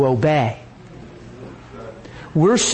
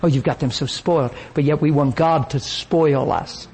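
ordinary talk

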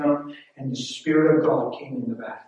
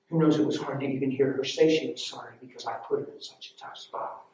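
ordinary delivery

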